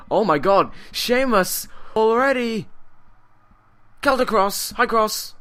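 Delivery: loud, high-pitched shouting; excited play-by-play. Real, not acted. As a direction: shout the big move in astonishment. Live call of wrestling finisher.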